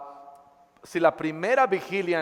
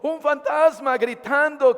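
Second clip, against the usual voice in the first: building fearful shout; 6 semitones higher, 4 dB louder than usual. Shown as a demonstration. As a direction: voice rising higher and louder with fear. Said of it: "Quiero gritar". (voz de miedo que sube)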